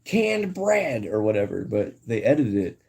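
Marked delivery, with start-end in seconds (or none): 0.0-1.1 high, amazed cartoon voice; Squidward. Streamer quoting a supermarket cartoon scene, high mock amazement. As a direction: gush in mock awe, pitched well up. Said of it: "Squidward in the supermarket".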